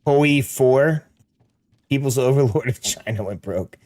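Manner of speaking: ordinary talk, nothing marked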